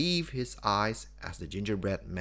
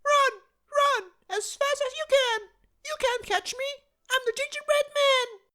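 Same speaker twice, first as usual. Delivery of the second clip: very high, louder taunting voice; gingerbread man yelling; 6 dB louder than usual. A narrator's reading. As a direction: taunt them at the top of your voice. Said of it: "laughed and yelled at the top of his voice".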